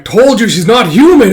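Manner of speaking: loud, high angry shout; angry insistence. Acted. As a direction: snap back furiously. Ren angrily insists.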